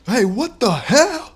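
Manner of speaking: high, loud, slow angry yell; furious at the misfire. A narrator's reading. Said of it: "yelled the gang members".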